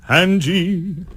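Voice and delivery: loud, high sung British accent; very strong British accent. Shown as a demonstration. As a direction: belt it out in an overdone British accent. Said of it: "with a very strong British accent".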